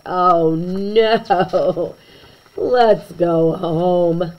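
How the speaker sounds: slow, drawn-out wail; hammed-up family groan; mock dismay. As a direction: whimper to go home. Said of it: "we all cried".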